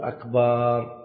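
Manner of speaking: long held "aa" after the b in "أكبر"; stretched ba. Shown as a demonstration. Teacher demonstrating a mistake to avoid, ba drawn out long. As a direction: draw "أكبر" out into "akbaar". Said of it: "أن لا يمد الباء".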